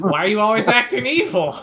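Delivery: high, loud and fast teasing outburst; mock exasperated. Real, not acted. GM teasing the player about acting evil, loud and playful. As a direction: tease the player with a big playful protest.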